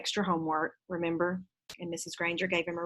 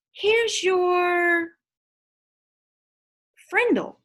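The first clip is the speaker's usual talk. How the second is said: much higher and louder, trying out a new word; boy's voice; 11 semitones higher, 7 dB louder, much slower than usual. Acted. Nick handing Janet the pen, higher and playful. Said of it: "he said".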